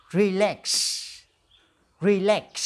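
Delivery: Relax. with a crisp ks at the end; clear final ks. Shown as a demonstration. Teacher modelling Relax. so students hear the final ks. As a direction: say Relax. ending firmly on ks. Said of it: "Now repeat after me".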